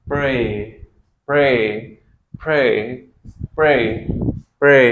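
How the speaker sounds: "प्रे" said loudly as pray with an ei glide; loud repeated model. Shown as a demonstration. Teacher loudly drilling a word for students. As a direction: repeat "प्रे" five times clearly. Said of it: "इसको क्या बोलेंगे हम?".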